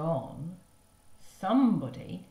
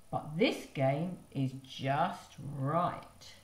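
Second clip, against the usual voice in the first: slow satisfied; much slower than usual. Acted.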